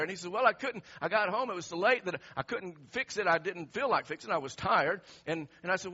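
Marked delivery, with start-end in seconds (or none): 0.3-5.0 imitating loud, exasperated excuses; mad coworker. Coworker explaining why the car is still there, loud and exasperated. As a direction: protest loudly, piling up the reasons. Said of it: "it really made him mad".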